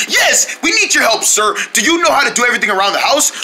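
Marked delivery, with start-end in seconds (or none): none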